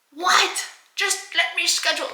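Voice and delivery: high-pitched, incredulous plea; exasperated disbelief. Retold or imitated. Customer who only wants the appointment, exasperated. Exasperated reply to upselling caller.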